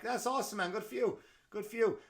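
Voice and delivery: high, gushing fake praise; mock enthusiastic. Bank clerk gushing at customer's birthday news, high mock praise. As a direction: pile on hollow congratulations. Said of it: "I'm like".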